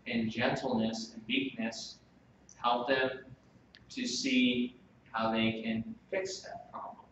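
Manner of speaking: soft, measured gentle counsel; gentle, meek. Shown as a demonstration. Preacher showing how to correct a wrongdoer instead, gentle and meek. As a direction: say it softly, as if helping someone.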